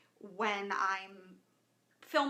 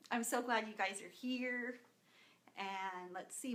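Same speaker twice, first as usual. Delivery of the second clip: ordinary talk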